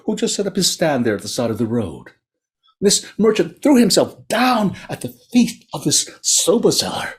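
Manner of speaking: plain reading voice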